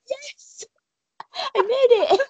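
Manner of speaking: high, thrilled squeal acting out the meeting; excited greeting, retold. Fan retelling her reply to her idol, excited.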